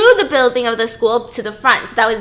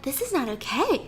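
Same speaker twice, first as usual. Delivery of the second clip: much softer, held back and cold; eerie quiet protest; 11 dB quieter than usual. Shown as a demonstration. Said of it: "But if you're like".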